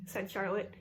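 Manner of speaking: ordinary delivery, nothing marked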